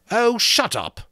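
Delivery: loud, high angry shout; savage snap. A narrator's reading. Ralph to the boys, savagely shouting. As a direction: cut him off furiously. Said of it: "made him savage".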